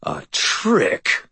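mildly angry, high-pitched exclamation; mounting anger. A narrator's reading. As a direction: let indignation rise in the pitch. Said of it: "Anger began to pour off of him".

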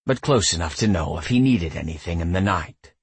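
ordinary delivery, nothing marked

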